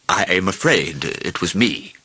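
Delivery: slow, apologetic king; sheepish confession. A narrator's reading. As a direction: own up slowly and sheepishly. King owns up to old lady.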